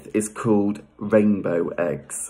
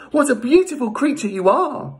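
high-pitched storybook admiration; very high, smitten character voice; Leda's adoring coo. Acted. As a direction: coo high over a beautiful pet. Voicing Leda admiring the swan.

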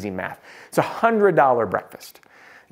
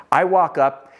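ordinary delivery

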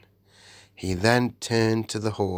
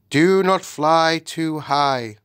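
higher, louder and slow; grave lion warning; 8 semitones higher, 6 dB louder, slower than usual. A narrator's reading. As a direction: warn them with solemn authority. Aslan warns the travellers.

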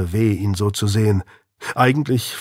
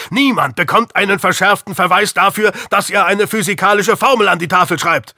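father shouting at his lying son; angry shouting; 8 semitones higher than usual. Acted. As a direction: yell at the son, losing patience. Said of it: "Diller schrie jetzt".

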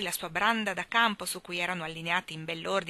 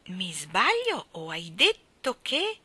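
slow, menacing, words ground out; through the teeth; 5 dB louder, slower than usual. A narrator's reading. Mary Poppins to Michael, slow menace through teeth. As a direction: hold back the anger, word by word. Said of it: "ripeté le parole piano fra i denti".

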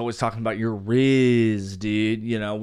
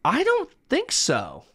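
very high, put-on squeaky voice; mock coy falsetto. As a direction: dodge the question in a hammed-up high voice. Hammed-up coy answer about sex.

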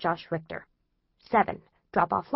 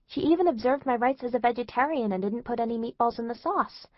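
ordinary delivery

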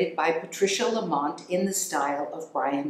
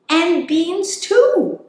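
much higher, louder slow squeal of delight; delighted hamster voice; 12 semitones higher, 7 dB louder, much slower than usual. Acted. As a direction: squeal it like a thrilled tiny hamster.

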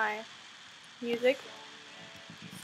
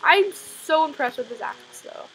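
ordinary talk

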